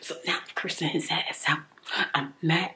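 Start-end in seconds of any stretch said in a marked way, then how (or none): none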